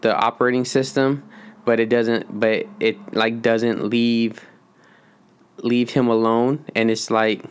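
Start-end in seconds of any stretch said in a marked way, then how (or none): none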